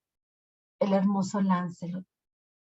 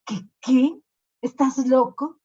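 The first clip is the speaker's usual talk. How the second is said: incredulous; 5 semitones higher, 5 dB louder than usual. Acted. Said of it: "le dijo el rey".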